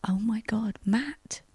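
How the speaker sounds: whispered name, soft and high; hushed swoon; breathless whisper. Real, not acted. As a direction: sigh his name like a crush.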